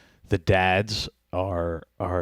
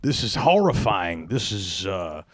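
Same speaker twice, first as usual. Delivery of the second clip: hammed-up outraged dad, higher and louder; mock outraged dad; 5 semitones higher, 3 dB louder than usual. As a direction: play a father exploding at the TV. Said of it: "I'm just imagining him".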